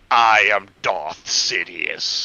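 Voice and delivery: slow, low menacing Sith lord; Darth Sidious. Retold or imitated. Podcaster imitating the Emperor's slow dark declaration. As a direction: intone it darkly and slowly. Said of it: "then he was like".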